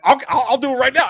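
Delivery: retelling a car argument as a fast, loud dare; mock heated challenge. Acted quote of heated argument.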